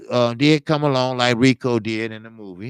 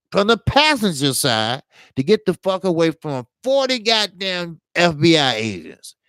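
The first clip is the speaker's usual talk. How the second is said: normal talking voice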